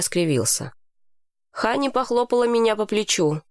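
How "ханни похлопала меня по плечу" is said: plain reading voice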